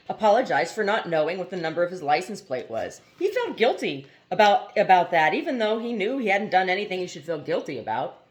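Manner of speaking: plain reading voice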